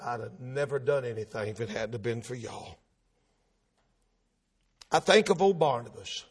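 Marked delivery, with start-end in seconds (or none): 0.0-2.8 very low, hushed thanks; humble gratitude. Acted. Paul at the feet of those disciples, humbly grateful. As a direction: let the voice drop almost away, indebted.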